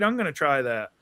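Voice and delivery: ordinary talk, nothing marked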